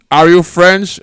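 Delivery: loud hammed-up president; mock demand. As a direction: bark the question at them. President voiced in mock scene.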